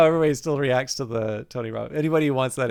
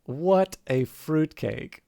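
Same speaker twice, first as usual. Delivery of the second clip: slow, dry aside; much slower than usual. Acted. Phoenix inner aside.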